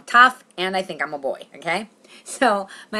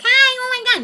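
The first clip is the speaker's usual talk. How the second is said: very high, loud squeal; Pat; 8 dB louder than usual. Retold or imitated. Actor greeting casting lady across room, squealing character. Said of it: "If I'm gonna be Pat".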